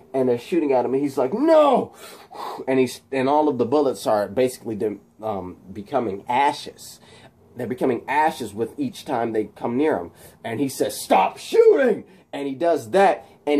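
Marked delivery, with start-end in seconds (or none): 1.3-1.9 loud high cry of protest; hammed-up refusal; acted desperate shout. Voices Electro under fire. 11.0-12.1 slow, loud, high yell; hammed-up drawn-out command; acted desperate shout. Voices Electro shouting at police.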